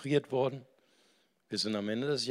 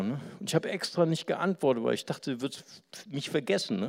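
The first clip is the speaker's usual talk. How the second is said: normal talking voice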